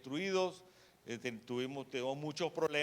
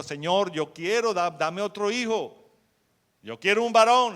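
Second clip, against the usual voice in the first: "Señor... dame otro hijo" cried out louder, rising toward "varón"; tearful pleading prayer; 5 semitones higher, 9 dB louder than usual. Retold or imitated. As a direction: beg God through tears, building in force. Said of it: "llorando, diciendo". (oración suplicante entre lágrimas, cada vez más fuerte)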